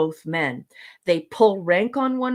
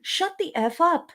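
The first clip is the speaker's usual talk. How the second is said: exasperated reaction; 7 semitones higher than usual. Acted. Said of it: "You're like".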